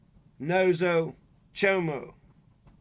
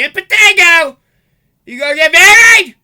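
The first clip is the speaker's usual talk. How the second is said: higher, much louder mock nagging; hammed-up screeching mother nagging at me; mocking mother yell; 11 semitones higher, 18 dB louder than usual. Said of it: "one just talking at me".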